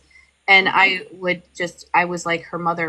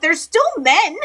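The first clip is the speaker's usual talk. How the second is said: high-pitched, emphatic protest; exasperated retort; 11 semitones higher, 4 dB louder than usual. Retold or imitated. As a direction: push back incredulously, pitch jumping up. Exasperated retort to the book's logic.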